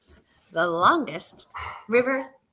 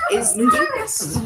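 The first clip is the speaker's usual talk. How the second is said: playful high voice; 4 dB louder than usual. Acted. Playful repeated question.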